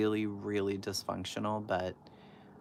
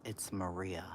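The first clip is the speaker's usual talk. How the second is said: retold whisper; 7 dB quieter, slower than usual. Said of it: "She's like".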